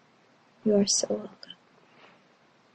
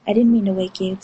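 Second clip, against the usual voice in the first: ordinary delivery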